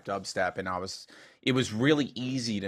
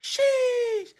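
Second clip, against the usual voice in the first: put-on very high, loud kid squeal; imitated little kid; 6 dB louder than usual. Imitating a seven-year-old's hype voice memo, little kid squeal. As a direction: squeal it like an excited small child. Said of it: "his kid just going".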